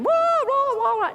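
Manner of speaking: high, hammed-up "wah wah" wail; mock freaking out. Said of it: "joy is like freaking out".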